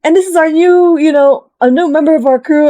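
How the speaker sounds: high, put-on announcing voice; host's grand introduction. Count Luis introducing a new crewmate, mock-grand announcement. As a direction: present someone proudly to the whole party. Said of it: "and says, like".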